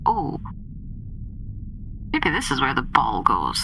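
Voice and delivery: ordinary talk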